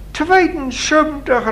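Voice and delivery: high-pitched cry of disbelief; astonished exclamation. A narrator's reading. Visitor reacts to Lina's age, exclaiming in surprise. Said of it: "riep de kaarsman".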